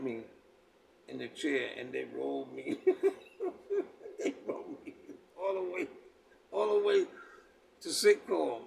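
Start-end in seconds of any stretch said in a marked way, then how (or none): 2.8-4.1 high laugh. Real, not acted. Recalling being rolled in chair. 4.2-8.6 high, laughing, half-disbelieving; laughing retelling. Real, not acted. Telling friends how brothers wheeled him to sick call, laughing.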